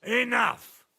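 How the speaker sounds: a high, loud angry bark; furious shout. Acted. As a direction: slam the word down to end it. Lord cuts off the threats.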